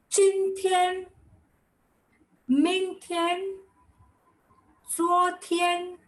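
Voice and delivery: Chin Tian, Ming Tian, Zuo Tian each high and spaced apart; three words said slowly, long pauses between; slow careful model. Shown as a demonstration. Teacher reciting the three day words carefully. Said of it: "So we'll say today".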